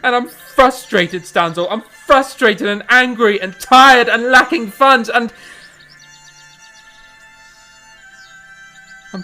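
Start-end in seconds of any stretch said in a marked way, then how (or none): 0.0-5.3 louder and louder angry list; pouring out frustration to his wife, angrily; frustrated outburst. Acted. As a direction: let the anger spill out word by word.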